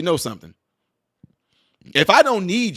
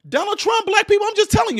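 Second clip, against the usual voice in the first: high call-out; 13 semitones higher than usual. Real, not acted. Calling out to audience.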